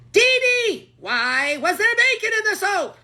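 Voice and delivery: shrill cartoon alien shouting; Zim voice. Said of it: "say a Zim line".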